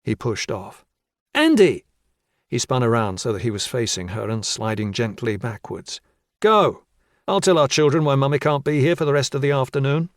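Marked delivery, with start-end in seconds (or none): 1.3-1.8 loud high call of his name. Acted. Wife calling loudly after her husband. 6.4-10.1 loud, high sarcastic send-off; bitter retort. Acted. Husband snapping at his wife as he leaves, loud and bitter.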